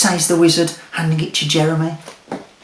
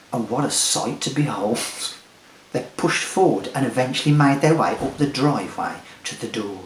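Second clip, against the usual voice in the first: ordinary delivery, nothing marked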